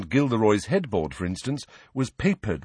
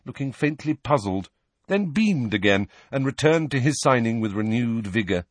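plain reading voice

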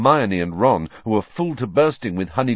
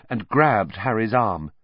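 usual speaking voice

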